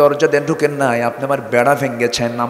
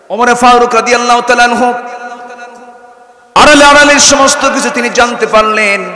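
loud, high sermon shouting; fiery Bengali waz climax; preaching at full force; 10 semitones higher, 6 dB louder than usual. Real, not acted. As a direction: rouse the crowd, full voice. Preacher's fiery aside to crowd.

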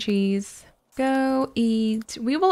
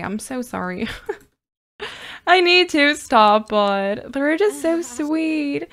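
ordinary delivery

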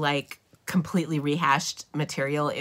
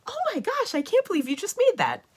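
high-pitched gushing quote of amazed friends; imitated amazement.

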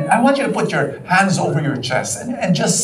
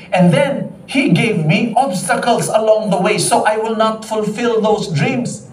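higher, imitating a frustrated woman's complaint; a hint of a hurt, angry woman; 6 semitones higher than usual. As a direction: voice her pain lightly. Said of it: "And she told me this".

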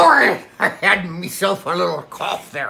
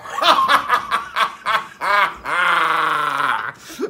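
big booming monster laugh, shouted out; Godzilla's laugh. Acted. Pirate acts out Godzilla laughing at the Kraken, a booming laugh. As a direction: let out a huge gleeful monster laugh. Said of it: "and Godzilla's laughing. Like that".

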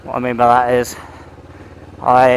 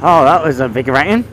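ordinary talk, nothing marked

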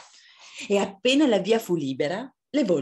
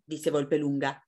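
plain reading voice

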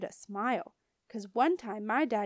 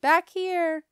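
raised, higher calling voice; 8 semitones higher, 8 dB louder than usual. A narrator's reading. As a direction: call from another room. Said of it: "called Mother".

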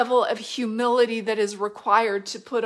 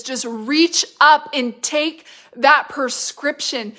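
louder, driving preacher's cadence; preaching at full force; 4 semitones higher, 7 dB louder than usual. Acted. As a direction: proclaim it like a revival preacher. Exhorting the congregation.

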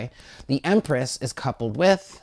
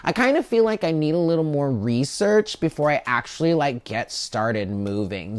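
hesitant stalling. Acted. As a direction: stretch the words out like someone putting it off. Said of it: "Do you hear the tone in my voice".

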